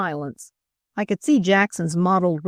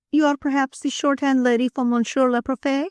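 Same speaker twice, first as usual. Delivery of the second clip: high, polite French madame; inquiring Frenchwoman; 7 semitones higher than usual. A narrator's reading. Madame guessing who the woman is, politely curious. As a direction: ask in a high voice, lifting to the end.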